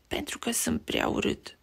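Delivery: soft unvoiced whisper; little rabbit whispering his shame; almost whispered. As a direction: breathe it out ashamed. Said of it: "spuse Beni aproape șoptit".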